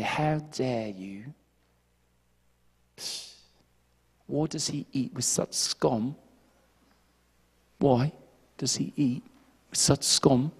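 slow, soft, haughty mock British voice; snooty British. Pharisees looking down on sinners, in a snooty British accent. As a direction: noses up, sniff it out in British. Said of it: "they put their noses up".

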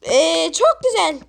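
ordinary delivery

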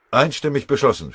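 loud, high, satisfied announcement. A narrator's reading. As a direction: declare the result with satisfaction. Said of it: "stellte Maschinger befriedigt fest". (befriedigt, laut verkündet)

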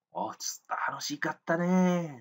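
high, bright mock delight; a staged cheerful exclamation in a classroom example. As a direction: exclaim how fun it was, hammed up. Acted exclamation in example dialogue.

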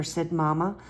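usual speaking voice

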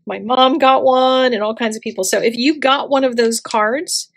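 ordinary delivery

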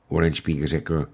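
plain reading voice

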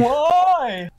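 very high, drawn-out "why"; loud, long mock wail of disbelief; mock dismay. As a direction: stretch it out high in playful protest.

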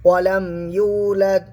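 slow lifted recitation; measured recitation; Quran recitation. Shown as a demonstration. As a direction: recite it slowly and evenly.

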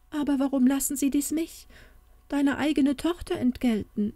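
leicht gespieltes Weinen, höhere Stimme; weinerlich. A narrator's reading. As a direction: klage mit einem Anflug von Tränen. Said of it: "weinte Märchen". (weinerlich angedeutete, hohe Klage)

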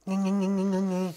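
monotone nyah-nyah mimicry; childish mocking gibberish; mocking taunt. Child mocking siblings' threats, mocking taunt.

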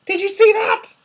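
high amazed question; stunned. A narrator's reading. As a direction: blurt it out in awe. Stunned son after sighting.

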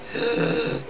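audible drawn-in gasp; inward breathing. Shown as a demonstration. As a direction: suck air in through the throat. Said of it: "Inward breathing".

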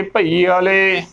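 ਭਈ ਵਾਲੇ as "bha-ee-aale"; wrong added vowel. Shown as a demonstration. Teacher showing the slip, mispronunciation. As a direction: add an "aa" before the le of ਭਈ ਵਾਲੇ. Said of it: "ਤਾਂ ਗਲਤ ਉਚਾਰਨ ਹੋਵੇਗਾ".

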